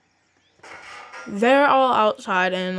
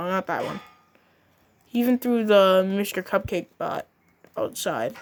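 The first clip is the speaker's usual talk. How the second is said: ordinary delivery, nothing marked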